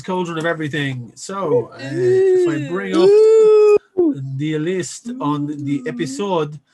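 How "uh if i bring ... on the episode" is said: a slow, lightly put-on French accent; host's accidental mock French accent, drawn out. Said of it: "I don't know why I'm French all of a sudden".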